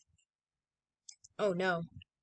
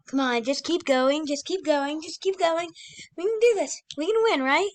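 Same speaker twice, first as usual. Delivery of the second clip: rising, higher encouragement; excited urging; 7 semitones higher, 4 dB louder than usual. Real, not acted. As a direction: push them on, getting more eager. Cheering on teammate.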